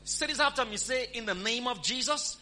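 preacher leading a spoken confession, full force; preaching at full force. Real, not acted. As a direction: lead the crowd in a declaration. Leads congregation in declaration.

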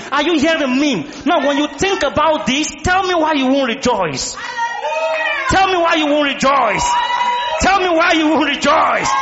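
high, building cries to the congregation; full-force preaching. Real, not acted. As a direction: drive it home, rising to a shout.

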